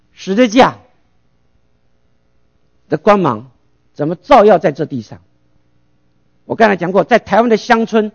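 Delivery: ordinary delivery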